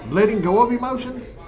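puzzled echo. Real, not acted. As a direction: repeat it baffled, pitch up on "of".

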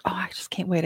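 breathless whisper, can't wait; whispered imitation of the eager guest; whispered eagerness. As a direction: whisper it like a giddy secret. Young guest eager, whispered quote.

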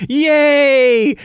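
loud high whoop of joy; excited cheer. Acted. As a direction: cheer like kids on a flying ride. Players cheer riding the dragon.

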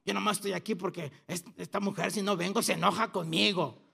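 low, softened voice of a resentful husband; defensive grumble. Acted. Husband resentful at being made to come to church, defensive grumble. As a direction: grumble it under your voice, on the defensive. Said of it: "con la actitud a la defensiva".

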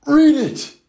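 high loud urging; shouting. Acted. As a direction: shout it eagerly. Kids urge him on.

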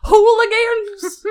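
put-on old prospector. Old man voice game continues.

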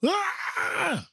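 high-pitched squeal of pretend horror; mock scream. Reacting to the film's many children, mock scream. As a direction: let out a high comic shriek.

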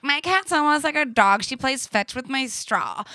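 mock bragging, pitched well up; mimicking other pet owners. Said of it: "Everyone's like".

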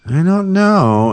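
high, slow, drawn-out I don't know; puzzled drawl. Retold or imitated. Coworker Nikki, puzzled by the pig reading, slow and high. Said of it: "she goes".

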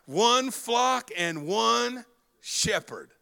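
slow, stress on one; preacher savoring the verse for the congregation; drawn-out proclamation. Acted. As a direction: stretch out one flock, one shepherd. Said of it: "Come on, somebody".

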